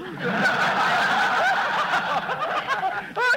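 a long laugh that shoots high; high shrieking laugh. Real, not acted. Husband cackling at his wife's comeback.

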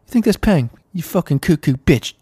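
very high, loud cartoon tough-guy voice; mock British rant. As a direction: rant like a squeaky London bruiser. Mock British hard man rant.

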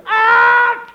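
huge comic sneeze; loud hammed-up sneeze. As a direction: let the loud fake sneeze blow out. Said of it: "Did you hear [name] sneeze".

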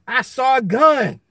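playing the guilty shooter; mock defensive shout. Answering the others about why he shot, mock shouting. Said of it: "They be like".

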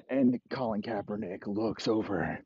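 normal talking voice

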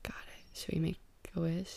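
soft unvoiced whisper. Real, not acted. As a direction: whisper it close and gently. Removing an eyelash up close.

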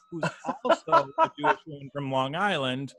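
self-deprecating, laughing as you go; amused self-mockery. Real, not acted. Broadcaster mocking his own Michigan accent, amused. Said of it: "I sound like a fat guy from Chicago".

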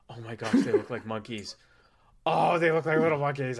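a light, lightly voiced cooing alien; gushing alien. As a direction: coo over them like cute pets. Aliens gush over humans.

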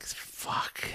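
breathy whispered swear under the breath; whispered curse. Retold or imitated. Realizing he is outnumbered, a whispered curse. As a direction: mutter it to yourself. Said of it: "I was just like".

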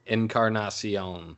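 Encarnacion said slowly, syllable by syllable; careful Spanish pronunciation. Shown as a demonstration. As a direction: stretch out Encarnacion with Spanish vowels. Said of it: "Can we get a pronunciation on that".

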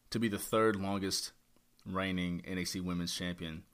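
ordinary talk

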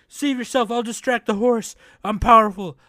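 high, loud heroic declaration; hammed-up teenage hero, pushed loud and high; mock badass hero. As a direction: overblown anime bravado. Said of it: "he's acting all badass".

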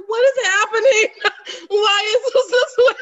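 high, loud mock bewilderment; retold childhood alarm, loud and baffled; confused kid. Podcaster recalls her confusion at weaves as a kid, loud and bewildered. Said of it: "Confused as a kid".

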